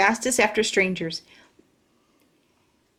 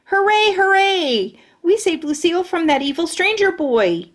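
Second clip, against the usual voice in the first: triumphant cheering; 8 semitones higher, 4 dB louder than usual. Acted. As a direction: crow about the rescue. Celebrating the rescue.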